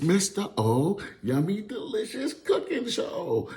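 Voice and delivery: slow, high showman intro; show host announcer. Pretend. Announces his show title.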